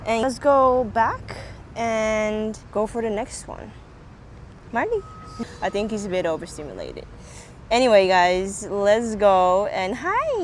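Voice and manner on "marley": high, rising call of a name; calling a dog. Real, not acted. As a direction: call the dog over brightly.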